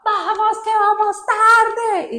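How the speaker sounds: loud, stretched cry of "vamos tarde"; imitating dad yelling. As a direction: honk at the family like an impatient dad. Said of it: "el papá pitaba".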